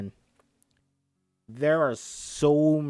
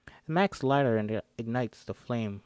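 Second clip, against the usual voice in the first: ordinary delivery, nothing marked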